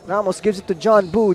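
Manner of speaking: ordinary talk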